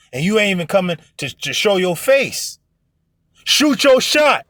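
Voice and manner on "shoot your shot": high, slow, punched-out phrase; emphatic preacher's command; emphatic urging. Real, not acted. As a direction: drive the words home slowly. Urging women to pursue men.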